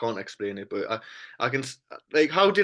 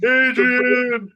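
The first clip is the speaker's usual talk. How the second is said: loud anguished call, pitched up; shouting name; 7 semitones higher, 7 dB louder than usual. Acted. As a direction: bellow the name across the ring. Calling out, Rocky quote.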